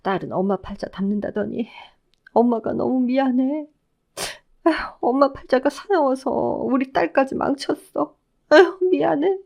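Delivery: grieving mother's high, sorrowful voice; weepy, self-blaming mother; tearful lament. A narrator's reading. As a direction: apologise through tears, rising into a sob. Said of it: "하염없이 눈물을 흘리셨어요".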